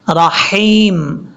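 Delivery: slow 'رحیم' with long ee; fine ha. Shown as a demonstration. Teacher models the letter ha, slow correct recitation. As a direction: stretch 'رحیم' on a thin throaty ح. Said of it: "یہ ہا بریک ہے".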